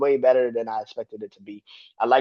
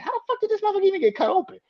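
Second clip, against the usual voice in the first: baffled high squeak; much faster than usual. Retold or imitated. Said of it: "I'm like".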